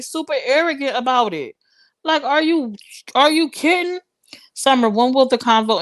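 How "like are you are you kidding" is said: rising pitch, incredulous; can't believe what he did; high, stunned "are you kidding"; outraged disbelief. Real, not acted. Outraged at the man.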